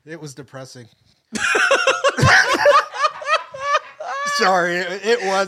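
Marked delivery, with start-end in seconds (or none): 1.3-4.3 high burst of helpless laughter; big laugh. Real, not acted. Friend bursts out laughing recalling the bomb night. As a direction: cracks up hard remembering it.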